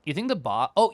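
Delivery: ordinary talk, nothing marked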